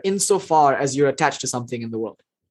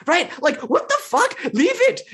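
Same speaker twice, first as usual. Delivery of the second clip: much higher, incredulous exclamation; exasperated outburst; 13 semitones higher than usual. Real, not acted. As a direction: throw up your hands in disbelief. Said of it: "exasperated reaction".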